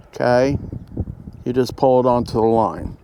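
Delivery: ordinary talk, nothing marked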